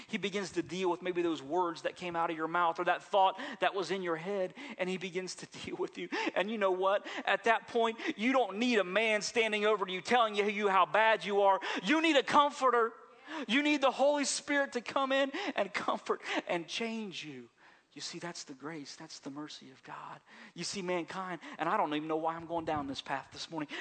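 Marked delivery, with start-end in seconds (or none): 6.3-17.5 loud, high, building preacher's cry; full-force preaching. Acted. Pastor urging the congregation toward the Comforter, loud preaching.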